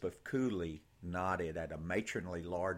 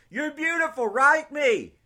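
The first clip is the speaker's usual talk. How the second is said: soldier calling out; 16 dB louder than usual. Acted. Soldier calls out from truck.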